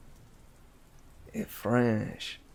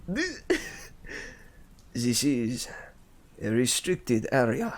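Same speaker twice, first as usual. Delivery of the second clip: bad French accent. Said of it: "So bad".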